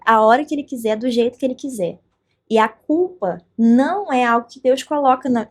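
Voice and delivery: usual speaking voice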